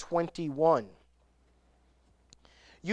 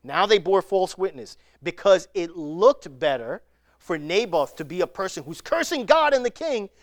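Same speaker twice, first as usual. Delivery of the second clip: usual speaking voice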